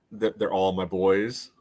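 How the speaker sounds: ordinary delivery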